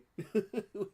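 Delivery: a quick bright laugh; a brief happy laugh; short real laugh. Real, not acted. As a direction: let a real laugh slip out. Laughing to himself on camera.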